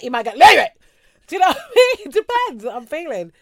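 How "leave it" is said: loud leave it. Shown as a demonstration. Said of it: "you might get variation".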